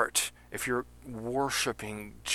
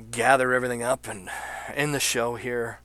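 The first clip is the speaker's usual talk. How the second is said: ordinary delivery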